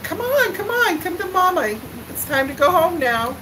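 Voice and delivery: high, sing-song coaxing; coaxing mama voice. Retold or imitated. Mother coaxing daughter away from the kids, sing-song. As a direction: coax the toddler over to Mama.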